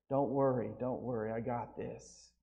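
soft, calm reassurance to the worried deacons; reassuring pastor. Retold or imitated. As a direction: settle them down, quiet and easy.

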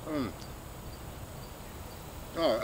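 ordinary talk, nothing marked

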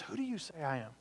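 Jesus quietly asking his followers; hushed question. Retold or imitated. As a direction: ask it softly, face to face. Jesus asks disciples intimate question.

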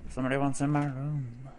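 ordinary delivery

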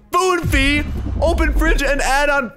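high, loud reading of the fees; outraged disbelief. Real, not acted. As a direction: exclaim at an absurd price. Outraged at the fridge price.

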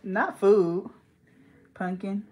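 normal talking voice

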